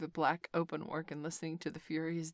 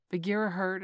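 usual speaking voice

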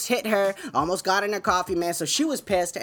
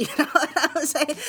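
higher-pitched, laughed-through aside; laughing; 9 semitones higher than usual. Real, not acted. Host amused at greeting his neighbors, real laughing.

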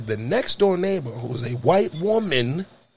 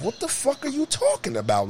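high pitched shock; can't believe what he hears; high incredulous protest; outraged disbelief; 11 semitones higher, 3 dB quieter than usual. Retold or imitated. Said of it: "I said".

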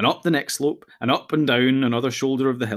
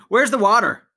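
higher, louder demand; shouting; 9 semitones higher, 6 dB louder than usual. A narrator's reading. Pippin shouts to Frodo for water, loud. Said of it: "shouted Pippin".

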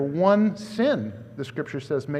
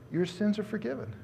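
tender words; 3 semitones higher, 8 dB quieter than usual. Retold or imitated. Jesus addresses the woman tenderly.

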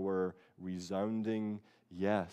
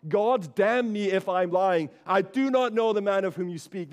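Peter's curse; 9 semitones higher, 9 dB louder than usual. Retold or imitated. Peter denying Jesus, cursing himself in loud desperation. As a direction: swear it out like a cornered man. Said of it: "he began to invoke a curse on himself".

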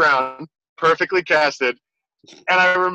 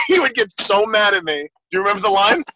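ordinary talk, nothing marked

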